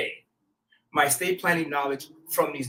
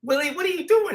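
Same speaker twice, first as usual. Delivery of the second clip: surprised call; 9 semitones higher than usual. Retold or imitated. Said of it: "I was like".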